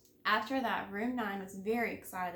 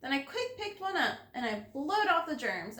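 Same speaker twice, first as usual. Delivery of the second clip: ordinary delivery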